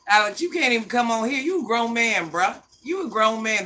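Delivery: ordinary talk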